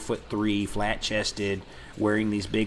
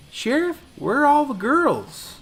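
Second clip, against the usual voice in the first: mock high kid's voice, louder; 13 semitones higher, 4 dB louder than usual. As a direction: voice the kid up high and a bit loud. Kid's question retold from movie.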